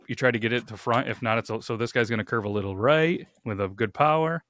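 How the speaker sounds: ordinary delivery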